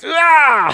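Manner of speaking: high, loud effort cry; straining grunt. Retold or imitated. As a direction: push like lifting something heavy. Straining to push energy.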